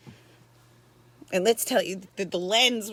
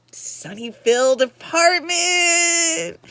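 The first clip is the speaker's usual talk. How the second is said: louder, slow, drawn-out mock grandeur; 6 semitones higher, 8 dB louder, much slower than usual. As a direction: stretch it out like a grand sneer. Mocking the neighbor's fancy flat.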